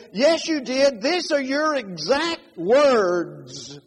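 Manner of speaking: high, loud mock-quarrel retort; acted-out accuser, loud and insistent; heated accusation. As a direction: throw the charge back loudly, dragging out the last words. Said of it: "he'd write and say".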